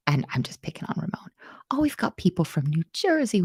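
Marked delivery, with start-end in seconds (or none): 0.1-1.3 whispered aside. Real, not acted. Teasing aside to chat.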